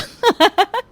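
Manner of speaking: high scheming giggle; gleeful cackle. Acted. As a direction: cackle at the prank to come. Plotters gloat over their prank. (ऊँची शरारती खिलखिलाहट)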